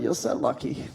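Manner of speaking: breathy whispered aside, half to himself; jealous little whisper; envious whisper. Retold or imitated. Envious aside while watching the girls.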